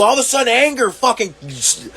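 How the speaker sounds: ordinary talk, nothing marked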